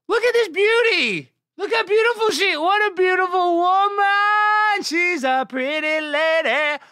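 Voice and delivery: high, loud, slow ironic gushing; mock swooning. Streamer mock-gushing over the goalie's photo, high and loud. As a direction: gush over her like a smitten fan.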